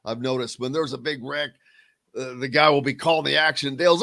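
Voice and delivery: usual speaking voice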